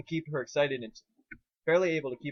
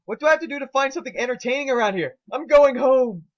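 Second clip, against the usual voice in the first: loud high furious shout; angry yell; 11 semitones higher, 11 dB louder than usual. A narrator's reading. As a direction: blow up and storm off yelling. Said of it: "stopped skating and yelled".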